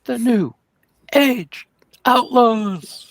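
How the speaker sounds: drawn-out high announcer call; ringmaster. Retold or imitated. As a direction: stretch the name out for the crowd. DX entrance promo imitation.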